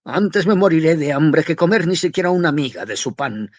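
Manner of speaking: high tenor shout. A narrator's reading. Student screaming at his mother in his highest tenor, loud. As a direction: scream it at the top of your voice. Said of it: "se puso a gritar con la nota de tenor más alta".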